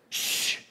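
soft unvoiced shush. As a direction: hush them all. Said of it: "the prophet says".

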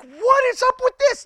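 high, loud disbelief; incredulous. Retold or imitated. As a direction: squeal it out in disbelief. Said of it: "I'm like".

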